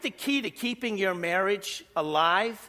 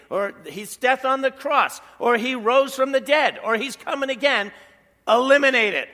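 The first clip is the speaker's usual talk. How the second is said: building emphasis; 5 semitones higher, 7 dB louder than usual. Real, not acted. Building list to a verdict.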